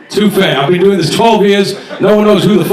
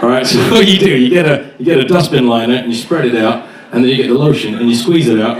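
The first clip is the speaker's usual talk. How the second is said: usual speaking voice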